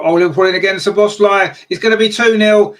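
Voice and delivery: loud, high burst as the goal goes in; excited goal call. Real, not acted. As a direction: erupt with real excitement at the goal. Goal scored, live commentary.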